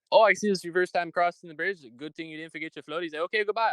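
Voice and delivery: high, rapid-fire toll collector teasing; toll booth guy. Retold or imitated. Toll collector teasing a first-time driver, high and quick. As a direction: rattle it off high like a cheeky toll booth man. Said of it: "he goes".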